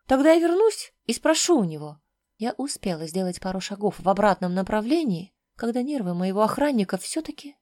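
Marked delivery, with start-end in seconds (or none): 0.1-2.0 higher, louder ultimatum; raised, pointed voice; defiant threat. A narrator's reading. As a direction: throw it out as a challenge. Heroine threatens the silent guard.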